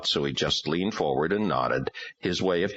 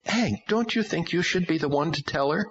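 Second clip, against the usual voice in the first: imploring; 14 semitones higher than usual. A narrator's reading. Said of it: "implored him".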